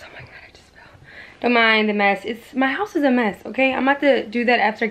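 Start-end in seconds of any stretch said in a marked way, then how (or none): 0.0-0.9 sudden hushed whisper, barely voiced. Real, not acted. Vlogger whispering to her viewers. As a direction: drop to a breathy whisper mid-sentence. Whispering aside while filming.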